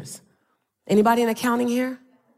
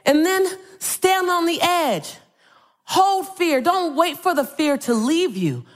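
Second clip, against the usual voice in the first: high, ringing call to the crowd; rally them phrase by phrase; rousing speaker's climax, raised pitch; motivational preaching; 4 semitones higher than usual. Real, not acted.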